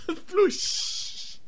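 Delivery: breathy mock whoosh; whooshing sound effect. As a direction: blow out a soft floosh. Imitates deploy sound.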